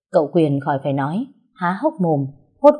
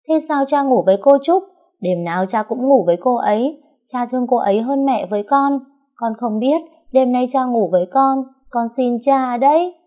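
put-on higher, louder child's voice on the verge of tears; a small girl begging through tears, higher; tearful little girl; 7 semitones higher, 4 dB louder than usual. A narrator's reading. Little Xíu tearfully pleads with father, higher child voice. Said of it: "Con Xíu ngần ngấn nước mắt".

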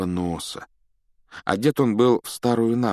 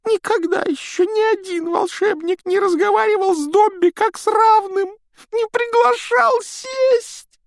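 very high, squeaky elf voice; eager little house-elf, squeaking; 8 dB louder than usual. A narrator's reading.

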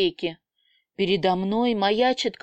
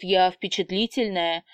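ordinary delivery

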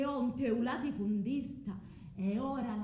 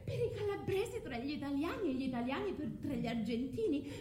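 tearful laughter; 6 semitones higher than usual. Acted. Medea laughs through tears.

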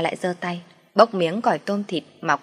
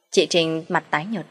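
usual speaking voice

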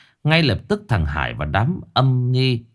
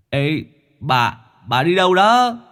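higher, rising startled call; calling after someone; 7 semitones higher than usual. Acted. Companion calls to Liễu as she leaves, raised questioning call.

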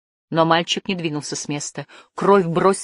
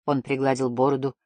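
plain reading voice

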